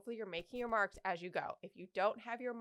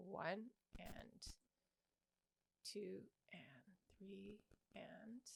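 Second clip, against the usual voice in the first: whispered counting; 12 dB quieter, slower than usual. Shown as a demonstration. Teacher counts quietly while writing.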